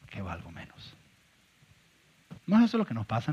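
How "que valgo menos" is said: fading whisper-quiet. Retold or imitated. Pastor admits to the church he feels worthless, sinking almost to a whisper. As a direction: drop to a near whisper on the last words.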